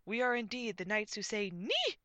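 loud knight's proclamation rising on knee; pompous, projected declaration; booming. A narrator's reading. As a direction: announce it grandly, climbing to a high knee. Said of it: "boomed the tall man".

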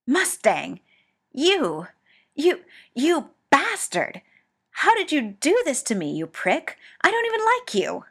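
loud high furious shout; furious yell. A narrator's reading. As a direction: scream it at him, beside yourself. Ed yelling at Mustang.

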